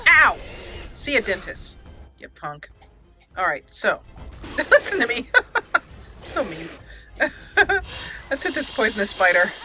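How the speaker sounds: ordinary talk, nothing marked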